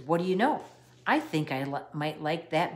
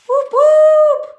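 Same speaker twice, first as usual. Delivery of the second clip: much higher, loud victory whoop; 16 dB louder than usual. A narrator's reading. Girl celebrates her recycled tooth idea, loud whooping. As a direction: cheer like you just won.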